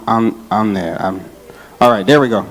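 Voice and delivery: normal talking voice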